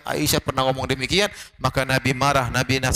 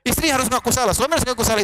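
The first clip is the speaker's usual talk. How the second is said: much higher, pleading scold at a quarreling couple; exasperated scolding; 8 semitones higher than usual. Retold or imitated. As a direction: scold both of them, fed up and pleading. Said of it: "bolak-balik saya bilang". (teguran jengkel bernada tinggi)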